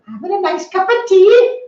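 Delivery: high, loud Welsh mum voice; sing-song Welsh accent, teasing. Retold or imitated. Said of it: "In a Welsh accent again".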